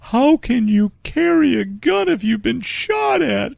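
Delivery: high, whiny moaning; mock whimpering lady. Storyteller mocking the lady moaning about guns, high and whiny. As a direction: moan it like a put-upon fusspot.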